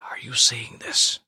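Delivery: hushed breathy whisper. Acted. Simeon whispers to Anna, awed, so as not to break the spell. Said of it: "Simeon whispered".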